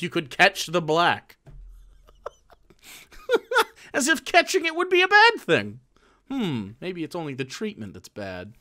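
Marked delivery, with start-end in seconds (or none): 3.0-5.8 laughing scoff. Real, not acted. Scoffing at a racist idea.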